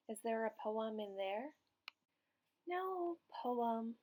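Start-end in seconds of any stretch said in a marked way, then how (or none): 2.7-3.9 high, light sighing voice; a little sighing kitchen voice; sighing noodles. A narrator's reading. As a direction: sigh it out like tired noodles. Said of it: "the noodles sigh in reply".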